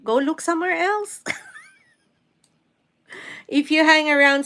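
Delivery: ordinary talk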